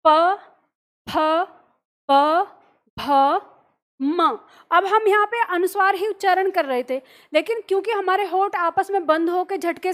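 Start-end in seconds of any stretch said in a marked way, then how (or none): 0.1-4.4 crisp lip sounds प फ ब भ म, each set apart; teacher's syllable drill; articulated letters. Shown as a demonstration. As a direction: pop the lips open for प फ ब भ म. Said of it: "तो हम इनका उच्चारण करेंगे".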